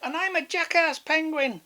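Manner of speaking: high, loud silly penguin voice; high comic penguin; jackass penguin. Acted. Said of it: "said another one".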